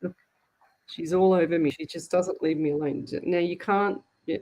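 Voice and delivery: normal talking voice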